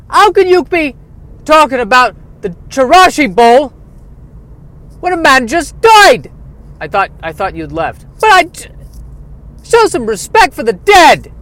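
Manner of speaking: high, loud Irish rebuke; indignant Irish scolding. Acted. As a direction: scold him for disrespect. [name] scolding the host.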